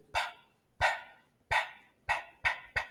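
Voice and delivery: pa as a soft pop of the lips, no voice; voiceless P. Shown as a demonstration. Teacher isolating P for learners, voiceless lip pops on pa. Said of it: "Là, je suis au niveau des lèvres".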